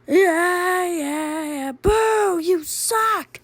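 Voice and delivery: high, drawn-out booing; jeering heckler. Acted. Audience member booing a bad band, jeering in a high voice.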